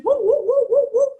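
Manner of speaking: high, loud whooping cheer. Real, not acted. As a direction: whoop to get the room going. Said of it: "At home, you can clap and cheer".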